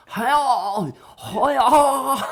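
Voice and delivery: put-on loud, high Dublin accent howl; over-the-top Dublin accent, wailed out; exaggerated Dublin accent. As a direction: bellow a hammed-up Dublin accent. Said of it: "that level of a Dublin accent".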